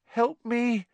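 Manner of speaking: high, frightened little moan; moaning plea. Acted. Neville begging Hermione for help, high frightened moan. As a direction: whimper for help. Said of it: "he moaned to Hermione".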